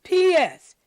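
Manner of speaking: ordinary delivery, nothing marked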